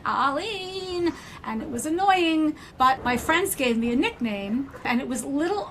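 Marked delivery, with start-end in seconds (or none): 0.0-1.1 put-on high Queens-accent call of a name; mother's Queens yell. Mother calling her daughter in a Queens accent. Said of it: "she would yell in her Queen's accent".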